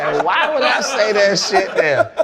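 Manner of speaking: laughing through the words; can't stop laughing at himself. Real, not acted. Player laughing at his own retort to a teammate.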